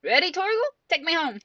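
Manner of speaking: high, eager call to a companion; rally the hound to lead on; calling the dog. Acted. Voices hero calling to dog companion.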